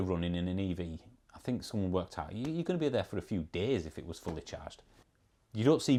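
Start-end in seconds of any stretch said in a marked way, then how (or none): none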